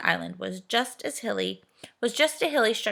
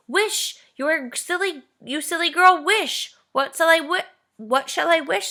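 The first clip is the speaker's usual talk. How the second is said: excited urging; 9 semitones higher, 7 dB louder than usual. A narrator's reading. Excited urging before wishing.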